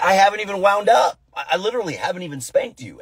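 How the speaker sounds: high, incredulous protest; exasperated father. Retold or imitated. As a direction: protest in disbelief to a panicking child. Exasperated father in acted scene.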